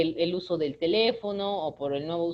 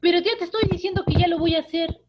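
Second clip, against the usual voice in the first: put-on much higher, louder whiny teen; whiny teen protest; 10 semitones higher, 6 dB louder, faster than usual. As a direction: whine back at mom like an annoyed teenager. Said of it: "siempre me lo dices en ese tono".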